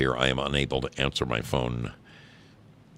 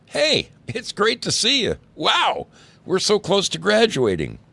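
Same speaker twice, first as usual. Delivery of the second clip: much higher, bubbly reunion greeting; excited greeting; 7 dB louder than usual. A narrator's reading. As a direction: gush at a long-lost friend. Acted happy greeting of old friend.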